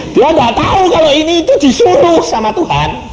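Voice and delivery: high laughing aside. Real, not acted. Laughing at father's ignorance. (tertawa geli)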